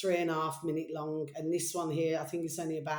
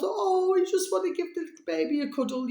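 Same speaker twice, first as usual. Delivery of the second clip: put-on much higher, fast, doting baby talk; tender cooing; 11 semitones higher, 4 dB louder than usual. Vlogger wanting to cuddle the sad cub, high doting coo. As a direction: gush over the cub like a baby.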